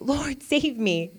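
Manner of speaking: high, drawn-out plea, read aloud; crying out. A narrator's reading. Peter beginning to sink, crying out. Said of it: "cried out".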